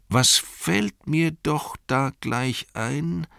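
high, drawn-out pondering; musing to himself. Acted. Innkeeper asks himself what he forgot, musing. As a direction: slowly grope for a lost thought. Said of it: "sagte er dann zu sich selbst".

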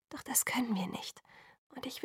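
soft unvoiced whisper. A narrator's reading. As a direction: let it fall to a hopeless whisper. Alice pleading, dropping her voice. (hoffnungslos geflüstert)

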